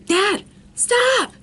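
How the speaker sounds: high, loud cry to stop; retold urgent shout. Daughter trying to stop her dad, urgent shout. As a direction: call out sharply, re-enacting the panic.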